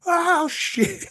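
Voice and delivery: high startled yelp of dismay; caught-out kids. Retold or imitated. Kids realising the door shut on them, startled yelp. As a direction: squeak it like a kid caught out.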